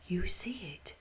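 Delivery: soft unvoiced whisper at my side. A narrator's reading. October whispering to the narrator while strolling beside her. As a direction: breathe it, no voice at all. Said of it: "strolled beside me and whispered".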